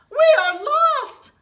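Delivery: high, loud, slow wail of doom; despairing wail. Acted. Townspeople facing the pasta flood, slow despairing wail. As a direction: moan it like all hope is gone. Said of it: "said the people".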